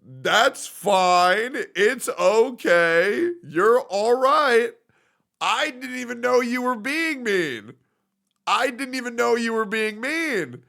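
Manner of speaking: high, slow, soothing reassurance; drawn-out soothing tone, comforting a crying friend; reassuring. Retold or imitated. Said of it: "And I'm like".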